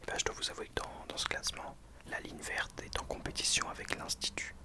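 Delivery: ordinary delivery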